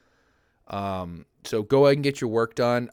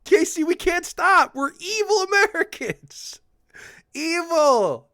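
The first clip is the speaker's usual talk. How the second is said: high, loud, slow cartoon villain squeal; mock evil villain; 7 dB louder, slower than usual. Joking to mod Casey, hammed-up high villain squeal. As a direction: ham up a silly evil villain.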